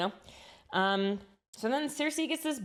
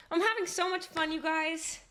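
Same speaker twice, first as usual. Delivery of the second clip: ordinary delivery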